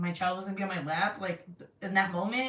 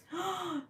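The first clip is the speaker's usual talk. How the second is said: high sharp gasp; surprised gasp; 10 semitones higher, 6 dB quieter than usual. Real, not acted. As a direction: gasp in delight. Reacts to Flamingo tigers.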